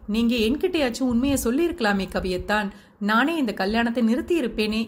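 ordinary delivery, nothing marked

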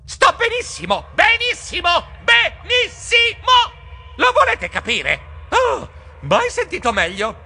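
high, loud, mounting yells; exasperated shriek. A narrator's reading. Geronimo fends off rescuers, shrieking in exasperation. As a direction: scream each repeat harder, then huff. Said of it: "Io strillai esasperato".

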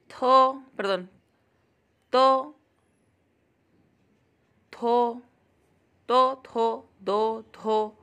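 each syllable called out loud and separate; loud alphabet recitation; loud syllable drill. Shown as a demonstration. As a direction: call each sound out clearly, like class recitation. Reads alphabet syllables aloud.

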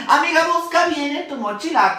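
high, exasperated plea to search; exasperated urging. Acted. Girl to her friend about a missing notebook, exasperated urging.

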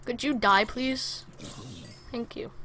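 ordinary talk, nothing marked

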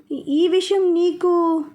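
slow, halting stammer on ఈ, ఈ. Acted. Caught out by his brother, Akash stammers slowly. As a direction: falter and trip over the first word. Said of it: "Akash తడబడుతూ".

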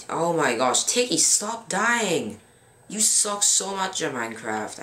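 real fed-up outburst; emotional, exasperated. Real, not acted. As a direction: scold your teammate, pitch jumping up. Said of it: "I'm getting emotional".

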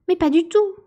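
loud, high indignant outburst; furious. A narrator's reading. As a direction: explode in angry denial. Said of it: "s'exclama la souris d'un ton furieux". (exclamation furieuse, forte et aiguë)

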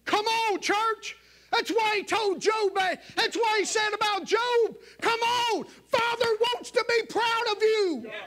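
high, loud fiery preaching; preaching at full force. Acted. Preacher stirring up the church, loud and high. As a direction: shout it out to the whole church. Preacher voicing God, climaxing.

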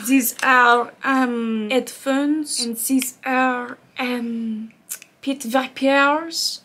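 very slow French accent on "pit vipers". Shown as a demonstration. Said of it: "Everything sounds better in French".

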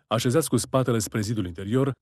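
ordinary delivery

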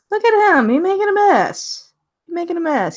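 thrilled at the messy little guy; excited. Real, not acted. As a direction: gush over the picture. Delighted by a card image.

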